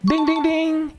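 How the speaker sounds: high ringing "ding" like a bell; game-show bell imitation. Recapper announcing the winning answer to viewers, high mock bell. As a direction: chime it out like a right-answer bell.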